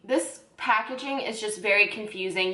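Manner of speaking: usual speaking voice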